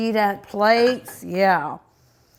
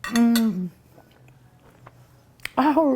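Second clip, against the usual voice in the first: ordinary delivery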